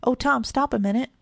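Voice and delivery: calling out. A narrator's reading. Kitty hailing Tom as he passes, calling out. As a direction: call out eagerly after a passer-by. Girl calls to passing boy.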